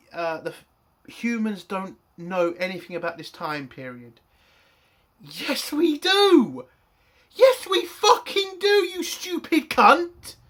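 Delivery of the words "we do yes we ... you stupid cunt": yelling, much higher and louder, at the screen; furious shout. Real, not acted. Reviewer shouting at the episode's writers, furious yelling. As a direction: blast the insult out in full rage. Ranting at Picard's wrong line.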